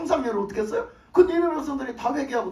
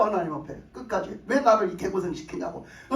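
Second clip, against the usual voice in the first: ordinary talk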